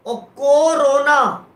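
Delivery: कोरोना drawn out slowly, split as को रोना; slow split reading. Shown as a demonstration. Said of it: "तत्सम प्रयोग हो गया". (कोरोना को धीमे, को-रोना करके)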